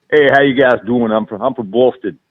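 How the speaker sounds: Boston accent. Shown as a demonstration. [name] shows podcast hosts a Boston accent on the spot. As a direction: drop the Southern twang and go Boston. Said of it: "Oh, that was good".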